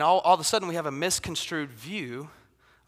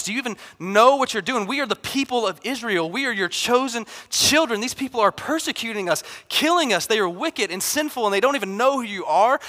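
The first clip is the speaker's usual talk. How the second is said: indignant; 3 semitones higher, 3 dB louder than usual. Retold or imitated. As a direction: rail at God for being unfair. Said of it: "what Jonah is doing in this moment is going".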